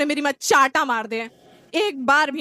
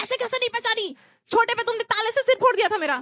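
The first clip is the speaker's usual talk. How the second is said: higher-pitched mock indignant cry; mock yell, high; 7 semitones higher than usual. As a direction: play the boy shouting, pitched up. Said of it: "इतना चिल्ला के बोले".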